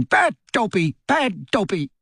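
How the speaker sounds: high, self-scolding elf cry; elf shouting. A narrator's reading.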